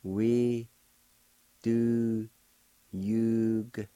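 We do yugta said word by word with pauses; teacher sounding out each word; split syllables. Shown as a demonstration. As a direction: break We do yugta into separate words. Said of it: "pronounce the G sound with the Y U like this".

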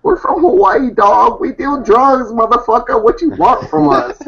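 high, hammed-up thug swagger; mock tough guy. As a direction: puff up like a street tough. Mock tough intro to interviewer.

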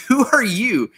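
high-pitched, baffled challenge; retold baffled question; incredulous. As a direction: throw it back in disbelief. Retells own incredulous challenge.

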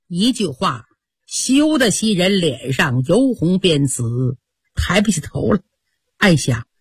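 ordinary delivery, nothing marked

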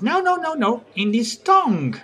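lightly acted high-pitched protest; quick high noes, dropping toward the end; Caterina. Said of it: "And Caterina goes".

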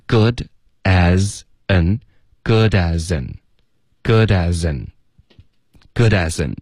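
"good as in" repeated slowly as goo-da-zin; slow, fully linked "good as in"; linked speech drill. Shown as a demonstration. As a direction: model "good as in" slowly with no gaps between words. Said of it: "这里都连读了".

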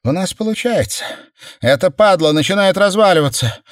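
high, thrilled exclamation; amazed, elated outburst; excited. A narrator's reading. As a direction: build to a loud cry of triumph. Amazed that the wall gives way.

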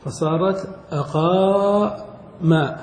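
فصارت أقام drawn out slowly; slow demo. Shown as a demonstration. Teacher slowly showing the final form. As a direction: land the new form slowly. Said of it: "ثم قلبوا الواو ألفا".

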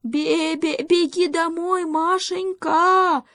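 very high little-goat shout; bleating cry. A narrator's reading. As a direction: bleat out an urgent call, drawing out the end. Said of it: "закричал козлёнок". (пронзительный крик козлёнка с блеянием)

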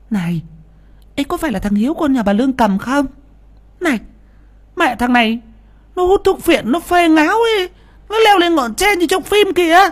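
high, shrill-pitched chattering woman; gossiping villager. Acted. Villager woman gossips about Hiếu to the crowd, high and excited. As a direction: blurt the gossip fast and eager.

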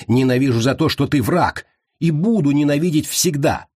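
high, angry declaration; hateful tirade. A narrator's reading. Prisoner tells his enemy why, hateful. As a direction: drive the hatred up in pitch. Prisoner rages at calm captor.

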